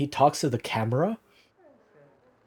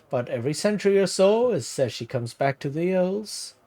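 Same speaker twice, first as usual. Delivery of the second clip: lightly voiced old villager, higher pitch, vaguely Irish; put-on Irish-ish accent; 3 semitones higher than usual. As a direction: spin the legend in a wobbly Irish lilt. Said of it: "something weird to Irish".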